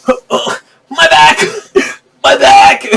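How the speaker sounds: high, slow strained groan through laughter; groaning old hero. Retold or imitated. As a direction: whine about the aching back, half-laughing. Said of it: "Tobey Maguire is like".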